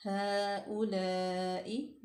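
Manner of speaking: slow stretched हाउलाई; long madd. Shown as a demonstration. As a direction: draw out हाउलाई. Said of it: "फतह इशबाई है".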